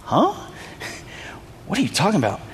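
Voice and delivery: high, fast, laughing what-are-you-talking-about; laughing confusion. Retold or imitated. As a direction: play the baffled woman through a laugh. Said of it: "she's like".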